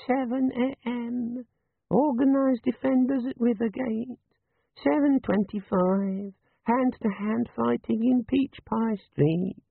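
high, slow, sleepy little device; drowsy bewildered gadget reading a schedule. A narrator's reading. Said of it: "lost its chirpy little edge and sounded sleepy and bewildered".

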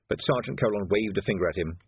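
ordinary delivery

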